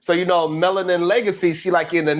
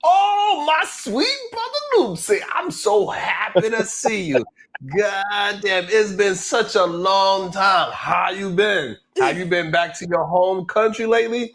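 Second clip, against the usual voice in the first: mock sweet greeting; 3 semitones higher than usual. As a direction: gush over him like a long-lost brother. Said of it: "Ilkan Ali was like".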